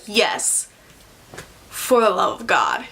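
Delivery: low, calm echo of the plea; unmoved reply. Acted. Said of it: "And he's like".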